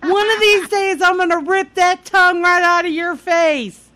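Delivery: high-pitched mock fury; retold angry threat. Furious mother to sarcastic daughter, mock angry threat. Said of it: "She was so mad".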